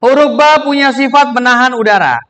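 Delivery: ordinary delivery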